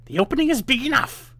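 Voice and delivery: loud high witch demonstrating; impatient witch. A narrator's reading. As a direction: show her how, exasperated. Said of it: "said she".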